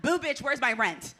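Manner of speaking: loud, laughing landlord demanding rent; cold greedy landlord. Pretend. Landlord persona scares tenant ghost.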